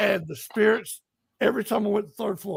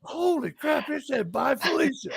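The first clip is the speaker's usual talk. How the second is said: high-pitched imitation of a stunned reaction; high-voiced mock astonishment; retold amazement; 7 semitones higher, 4 dB quieter than usual. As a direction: mimic someone amazed, pitched well up. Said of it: "And Kiwi was just like".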